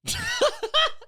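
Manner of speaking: shrieking laugh, high; real high cackle; high burst of laughter. Real, not acted. Laughing at own story.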